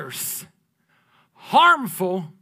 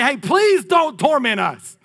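demons begging; 7 semitones higher, 6 dB louder, slower than usual. Retold or imitated. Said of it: "They come to say".

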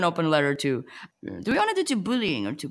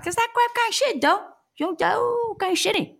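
much higher squeaky mock voice. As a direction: jump way up into a squeak. Squeaky high voice breaking in.

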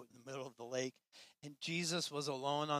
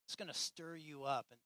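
softer, lightly voiced reassuring Jesus; gentle reassurance; 7 dB quieter than usual. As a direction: comfort them quietly. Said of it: "Jesus is like".

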